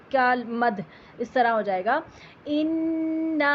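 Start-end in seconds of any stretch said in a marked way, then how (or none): 2.4-3.5 اِنَّا with the final alif stretched four counts, slow chant; four-count madd. Shown as a demonstration. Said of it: "لمبا کریں گے چار حرکت پر". (اِنَّا میں چار حرکت مد)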